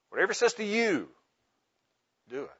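"you" lifted and held, then a pause; stress on you. Shown as a demonstration. Preacher showing another flavor of the verse, stress on you. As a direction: point the line at "you", pause before finishing. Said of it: "emphasizing one word at a time".

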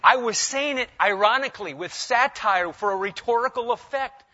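hammed-up highbrow excuse, voice climbing; mock pompous excuse. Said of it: "No, I say".

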